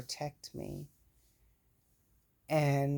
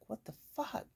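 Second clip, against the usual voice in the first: shocked disbelief; 8 semitones higher, 9 dB quieter than usual. Retold or imitated. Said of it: "And I'm like".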